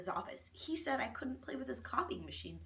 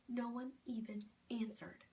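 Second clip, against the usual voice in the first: whispering; 8 dB quieter than usual. A narrator's reading. Narration turns to whisper.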